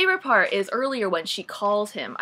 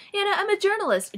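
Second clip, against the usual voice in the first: much higher, self-important mock tone; haughty high voice; 12 semitones higher than usual. Kate mocked as pretending to be a journalist, high and haughty. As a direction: play the pompous know-it-all. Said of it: "She's like".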